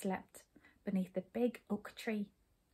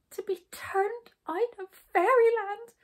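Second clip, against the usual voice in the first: high, slow, loud wail; weeping; 12 semitones higher, 6 dB louder, slower than usual. A narrator's reading. Jump lamenting banishment from Fairyland, tearful wail. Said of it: "wept Jump".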